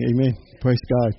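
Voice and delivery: ordinary delivery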